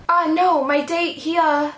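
lightly acted bashful reply; shy character voice. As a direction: lift the pitch and falter over the explanation.

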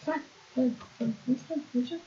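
tiny, soft high-pitched squeak; high, small voice. Acted. Player insisting they are fine after an outburst, small high voice. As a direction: reassure yourself in a very high, quiet voice.

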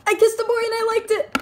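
fast, high put-on voice; silly voice. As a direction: rattle it off in a goofy high voice.

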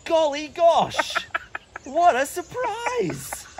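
very slow, hammed-up golly gosh; sarcastic wide-eyed mock amazement; fake surprise.

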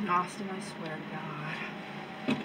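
low, slow, soft mutter; quiet, drawn-out exasperated warning; muttered exasperation. Acted. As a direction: mutter it slowly under your breath, fed up. Exasperated boss left with the wrestler.

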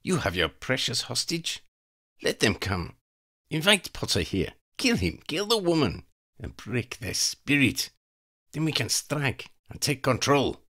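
high, cold voice of a female vampire plotting murder; scheming vampire woman. Acted. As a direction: savour each threat like a predator. Vampire Elena plots.

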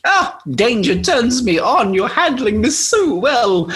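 high, slow, gushing come-on; mock flirtatious. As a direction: flirt shamelessly, drawing the words out. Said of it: "flirting like an absolute hussy".

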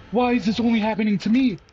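high, loud whining complaint; frustrated whine. Real, not acted. Cornered again, she whines at the game. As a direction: wail at the unfairness of it.

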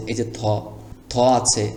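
ordinary talk, nothing marked

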